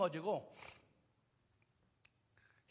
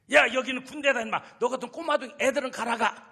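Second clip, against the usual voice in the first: loud, higher brushing-off scold; scolding soldier; 7 semitones higher, 12 dB louder than usual. Acted. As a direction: shoo the kid away. Soldiers dismiss boy David.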